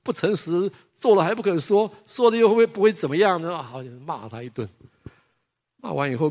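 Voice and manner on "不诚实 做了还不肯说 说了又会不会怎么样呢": put-on higher and louder scolding of a child; retold scolding. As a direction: scold the boy for not owning up. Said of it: "就当场就骂他".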